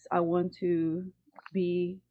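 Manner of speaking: ordinary talk, nothing marked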